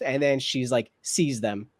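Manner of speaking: ordinary delivery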